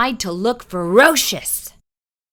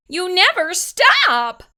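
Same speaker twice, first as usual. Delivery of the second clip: high, slow yell; mother shouting in exasperation; exasperated mother yelling at dog; 10 semitones higher, 4 dB louder, slower than usual. A narrator's reading. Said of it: "yelled Mother".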